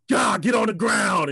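high, loud, slow shout; mock attack shout. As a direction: bark the order like a stage villain. Acting attack on wife.

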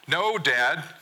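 high, protesting cry; yelled back. A narrator's reading. Son yells back at dad in the hall, protesting. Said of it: "you yell back at me".

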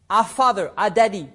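ordinary talk

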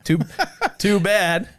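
high, loud ironic "too bad"; sarcastic. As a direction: fake regret with a laugh. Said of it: "Wink, wink".

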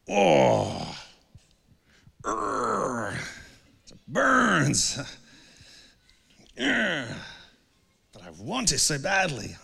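pained groaning, then a half-hearted plea; mock anguished groan. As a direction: ham up a tortured craving. Acting out temptation's inner struggle.

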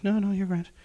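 high-pitched imitation of wife; wife brushing it off. Wife waves off husband's offer of help, high mock reassurance.

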